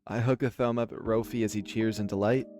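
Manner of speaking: ordinary talk, nothing marked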